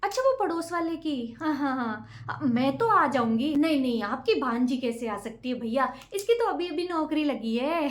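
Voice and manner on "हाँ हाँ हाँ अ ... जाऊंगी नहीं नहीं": ordinary talk, nothing marked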